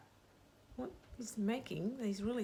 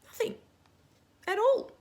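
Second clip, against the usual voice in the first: much higher, louder "at all"; big surprise; 10 dB louder than usual. Acted. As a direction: make the silence a shock. Car makes no noise.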